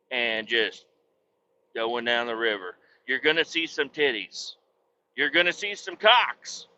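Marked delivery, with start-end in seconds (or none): none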